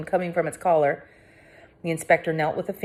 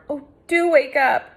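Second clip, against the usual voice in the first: begging; 8 semitones higher, 5 dB louder than usual. A narrator's reading. Begging friend to wake.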